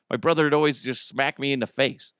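ordinary talk

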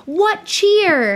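high, loud yelled greeting; miner yelling. Retold or imitated. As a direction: call out like a miner who found coal. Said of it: "he yelled".

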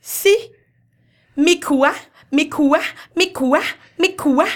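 insistent, repeated pretend-Japanese word; mock Japanese. Mum performing the story in Japanese, mock Japanese. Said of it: "Hun tager den på japansk".

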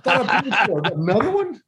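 high mock outrage at another bill. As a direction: play up the shock at paying again. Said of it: "And I was like".

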